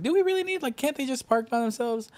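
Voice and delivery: doubtful questioning. Retold or imitated. Retelling own doubtful reply about staffing.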